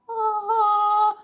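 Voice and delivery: high sung angelic aah; a high choir note from a magic box; heavenly choir ah. Retold or imitated. Mock heavenly ahh for the man-down advice.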